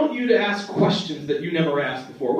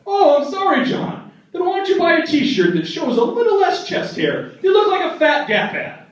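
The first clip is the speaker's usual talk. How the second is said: mocking grandpa; 11 semitones higher than usual.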